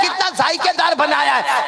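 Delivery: high-pitched admiring exclamation; gushing food praise. Acted. Husband keeps praising each mouthful, gushing. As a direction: lay the compliment on thick.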